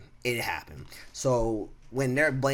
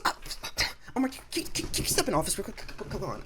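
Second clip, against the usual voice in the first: high, fussy imitation of the older woman; older coworker calling him into the office, mock high voice; 10 semitones higher, faster than usual. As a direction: squeak her nervous request way up high. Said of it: "She goes".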